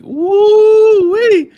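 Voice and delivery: very high, loud whoop; excited whoop. Retold or imitated. A kid with a dollar in his pocket, whooping.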